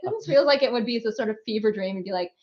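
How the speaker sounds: normal talking voice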